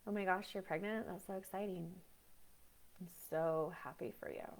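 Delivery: dull, soft and lifeless congratulations; flat fake happiness. Shown as a demonstration. Congratulating a pregnant friend, flat and soft with no feeling. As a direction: say it like you feel nothing at all. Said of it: "which is more abnormal?".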